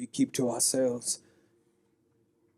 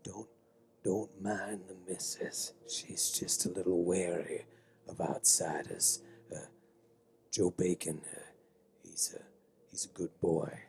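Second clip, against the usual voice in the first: a lightly voiced old local, low and hushed; old townsman; 8 semitones lower, 8 dB quieter than usual. Game master to players, lightly voiced old townsman. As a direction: lean in like a wary old local.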